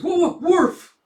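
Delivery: high, loud yelping woof; excited dog bark. Acted. Dog Bond barks.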